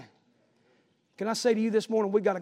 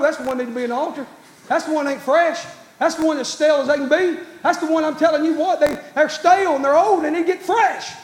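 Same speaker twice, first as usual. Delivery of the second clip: higher, louder altar call; preaching at full force; 6 semitones higher, 8 dB louder than usual. Acted. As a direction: build the altar call, pressing hard.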